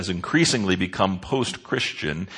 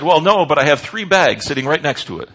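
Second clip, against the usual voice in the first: mock husband excuse; 7 semitones higher, 9 dB louder than usual. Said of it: "and he said".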